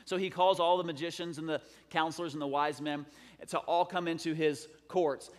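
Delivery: ordinary delivery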